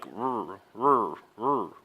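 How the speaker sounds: low repeated "rrr" grunts; grunted R sound. Shown as a demonstration. Teacher demonstrating the R to learners, grunt-like rrr. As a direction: growl out the bare R three times, low. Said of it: "the sound of a grunt".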